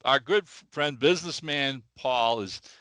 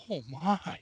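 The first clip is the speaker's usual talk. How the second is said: lightly voiced shocked old lady; a hint of a frail old lady gasping; 3 semitones higher, 6 dB quieter than usual. Old lady hears her cat was run over, mild shock.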